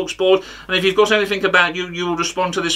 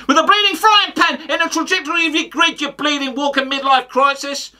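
mock outburst; 7 semitones higher than usual. Comic threatening the pundit as a punchline, mock outburst. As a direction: erupt high into a hammed-up insult.

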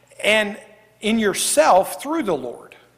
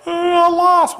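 put-on whiny kid; 8 semitones higher, 4 dB louder, slower than usual. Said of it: "kids are like".

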